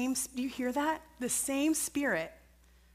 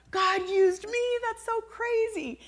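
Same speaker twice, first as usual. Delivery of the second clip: high, slow, amazed exclamation; awed delight; 11 semitones higher, 4 dB louder, slower than usual. Retold or imitated. Preacher reliving the joy of being used by God. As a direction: marvel at the moment.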